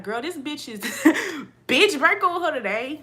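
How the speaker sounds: loud, high, laughing burst of advice; excited retort. Retold or imitated. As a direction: blurt it out, half laughing. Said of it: "I'm like".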